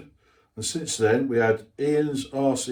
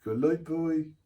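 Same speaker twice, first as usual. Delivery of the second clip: put-on Norfolk accent; 5 semitones higher, 5 dB quieter than usual. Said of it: "Bit like Norfolk".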